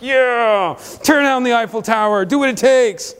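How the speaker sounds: cheering him on. Retold or imitated. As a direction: egg him on like a fan in the cinema. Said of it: "I'm like".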